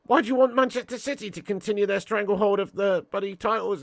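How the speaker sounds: ordinary talk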